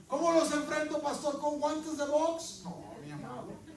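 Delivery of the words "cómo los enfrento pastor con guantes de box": higher, louder mock protest to the pastor; mock indignant member. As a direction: ham up the outraged churchgoer. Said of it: "member asks pastor".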